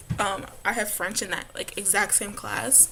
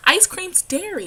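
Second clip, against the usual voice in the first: high, loud, drawn-out mimicry; mock the girls' claim, pitched up and slow; imitated girls; 10 semitones higher, 9 dB louder than usual. Vlogger quoting girls at Walmart, imitated girls. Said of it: "And they're like".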